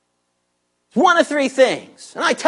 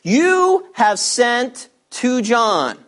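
"You" stretched out strongly, the rest slow and deliberate; emphatic YOU; much slower than usual. A narrator's reading. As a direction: hit "You" hard and hold it, then measured words. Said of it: "said strongly".

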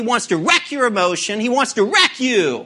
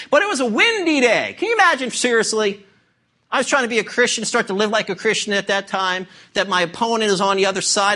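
ordinary delivery

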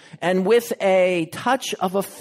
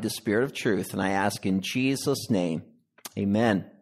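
hushed, earnest prayer voice; 4 semitones lower than usual. Acted. Preacher prays over the congregation, reverent and hushed. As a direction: lead the church in prayer, reverent.

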